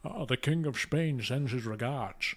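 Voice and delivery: put-on slow, slurred Connery-style Spanish accent; Sean Connery. Quoting the film character's first line, Connery impression. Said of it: "Sean Connery doing his, uh, absolute best".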